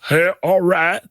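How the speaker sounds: high, badly faked Indian accent; botched mock Indian accent. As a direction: try an Indian accent and fail. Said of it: "he did an Indian accent".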